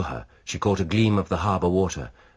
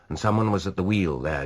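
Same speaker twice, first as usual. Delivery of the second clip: ordinary delivery, nothing marked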